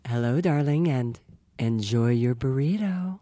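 low, slow hostess voice; horror hostess sign-off. Acted. Horror hostess takes out the show on request, low and slow. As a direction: purr it out slowly as the show's sign-off. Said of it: "take us out".

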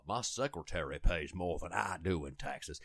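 low, cartoonish Southern blowhard voice; Warren Buffett. Mocking a billionaire's tax complaint, Foghorn Leghorn style. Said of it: "like Foghorn Leghorn".

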